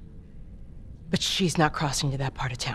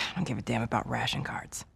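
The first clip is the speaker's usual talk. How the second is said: weary whisper; 4 semitones lower, 7 dB quieter than usual. Acted. Marlene wounded, dismissive.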